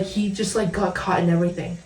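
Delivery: ordinary talk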